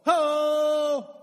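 loud shouted Ho, pitched high; a barker hollering to the crowd; barker's call. Acted. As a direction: bark it out like a carnival barker. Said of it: "A carnival barker".

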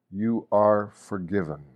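usual speaking voice

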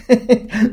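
a quick high burst of laughter; bright laugh. Real, not acted. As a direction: laugh at the naughty puppy. Laughs about Sprout's mischief.

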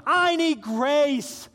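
loud, high, slow cry; imitated desperate scream from the pulpit; screaming plea. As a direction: belt out the need, stretching it.

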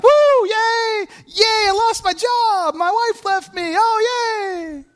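hammed-up high fake cheer that sobs; mock cheer. Mocking false joy over losing a job, high hammy cheer. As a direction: fake glee breaking into mock crying. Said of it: "It's not like".

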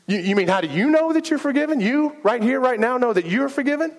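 high, fast, incredulous; can't believe he's asking this; stunned disbelief. Retold or imitated. Retold stunned reply to friend.

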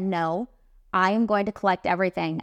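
normal talking voice

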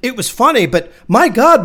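usual speaking voice

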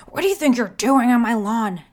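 loud husky shout; angry neighbour's husky yell. A narrator's reading. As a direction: bellow it from the doorway, husky. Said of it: "she yelled in a husky voice".

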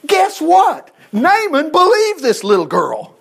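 spring it on the congregation, way up high; excited reveal. Real, not acted. Preacher teasing the congregation with a surprise, excited and high.

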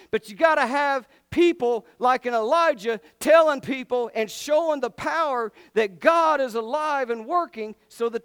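high, loud, fervent sermon; full-force preaching. Acted. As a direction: rouse the congregation with punched key words.

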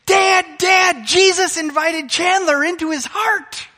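put-on high, loud, breathless kid voice; child shouting to dad in excitement; excited little boy. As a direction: play the thrilled young son running up. Pastor voices excited little son.

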